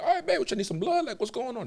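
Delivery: playing it cool with nurses; mock flirty. Retelling flirty line to nurses.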